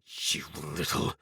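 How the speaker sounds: low growl cut off mid-insult. A narrator's reading. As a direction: growl it as he recovers from the blow. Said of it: "growled at her".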